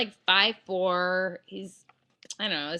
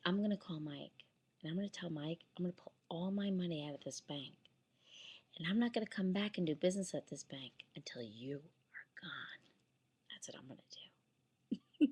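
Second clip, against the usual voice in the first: soft, cold words, dropping to a whisper; whispered ultimatum to the branch manager; quiet menacing threat; 4 semitones lower, 12 dB quieter than usual. Retold or imitated. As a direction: threaten him in a low hush. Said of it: "I swear this is how I'm talking".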